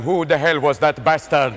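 [name] backstage. Retold or imitated. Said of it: "Mister [name] is backstage going".

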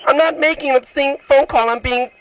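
high-pitched, wounded protest; mock indignation. Prank caller denies making an obscene call, high mock-hurt whine. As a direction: plead your innocence in an overwrought high whine.

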